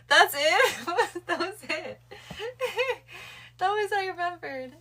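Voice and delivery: high, loud laughing through words. Real, not acted. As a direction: laugh while you talk. Laughing at her own comeback.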